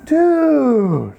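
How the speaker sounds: long high "dude", sliding slowly down; varied inflection. Shown as a demonstration. Californian showing tone of voice, long drawn-out "dude". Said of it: "in fifty different inflections".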